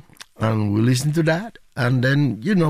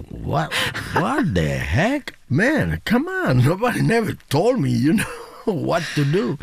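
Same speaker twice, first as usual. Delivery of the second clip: mock disbelief; 8 semitones higher than usual. Father retelling his shocked reaction.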